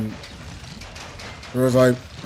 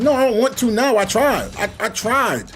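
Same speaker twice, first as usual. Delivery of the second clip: higher-pitched, mildly indignant protest; mock protest; 7 semitones higher than usual. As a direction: act out a fed-up refusal. Acted refusal to talk now.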